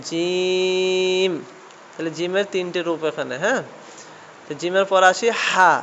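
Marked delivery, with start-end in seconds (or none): 0.1-1.3 জিম long and slow like a recitation; drawn-out letter name. Shown as a demonstration. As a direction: stretch out জিম slowly, Quran-lesson style. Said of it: "এটাও".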